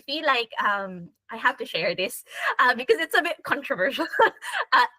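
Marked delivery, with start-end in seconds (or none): 2.6-4.1 said through a laugh, a bit faster. Real, not acted. Sharing a controversial travel pick with the group, laughing. 4.1-4.7 a sudden high burst of laughter; high giggle. Real, not acted. Laughing at her own controversial pick, high giggle.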